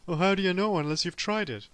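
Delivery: high, loud needling voice; mock taunt. An imagined smug friend taunting him, high and loud. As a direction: sneer it like a smug know-it-all.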